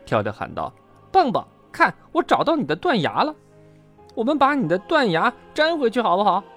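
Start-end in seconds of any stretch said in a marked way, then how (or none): 1.1-6.4 high, loud, excited calling; eager high-pitched shout. A narrator's reading. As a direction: call to your friend with a find.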